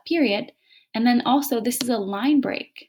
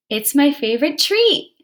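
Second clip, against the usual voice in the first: "treat" lifted excited, higher and louder; with expression; 6 semitones higher, 7 dB louder than usual. Shown as a demonstration. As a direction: read "treat" with excitement for the exclamation mark. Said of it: "you said treat with expression".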